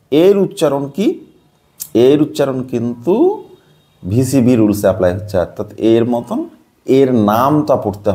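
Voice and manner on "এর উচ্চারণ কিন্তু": usual speaking voice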